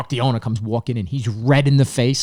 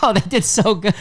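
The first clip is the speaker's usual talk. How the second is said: higher, laughing plea; mock pleading; 6 semitones higher than usual. Comic pleading with the host to spare contestants, laughing. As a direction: whine it on their behalf.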